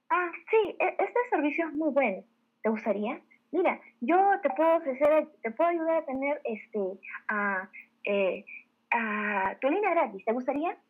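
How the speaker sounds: hesitant, unconvinced pitch, trailing into ums; unsure sales pitch. Shown as a demonstration. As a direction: stumble through the offer with filler sounds. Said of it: "una expresión como esta, y, y escucha la voz".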